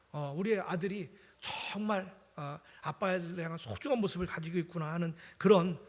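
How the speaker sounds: normal talking voice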